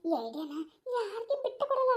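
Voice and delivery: normal talking voice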